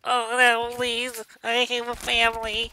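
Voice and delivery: high, loud, slowed fake begging; overacted caught-man plea; mock pleading. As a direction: whimper out a hammed-up plea. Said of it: "That's like, like".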